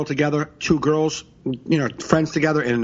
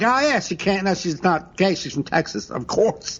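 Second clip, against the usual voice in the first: mock knowing agreement; 5 semitones higher than usual. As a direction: agree as if it's common knowledge. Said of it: "as if everyone here was like".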